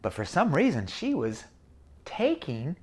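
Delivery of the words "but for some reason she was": plain reading voice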